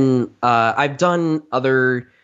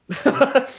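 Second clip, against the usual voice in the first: high cackle; 13 semitones higher than usual. Shown as a demonstration. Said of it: "something like that".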